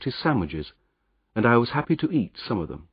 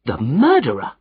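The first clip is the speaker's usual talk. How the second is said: a louder, alarmed cry; cried out; 4 semitones higher, 5 dB louder than usual. Acted. Frightened Jopley recognises the wanted man, mild cry. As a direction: recoil in fright. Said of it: "he cried".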